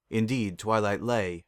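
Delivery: usual speaking voice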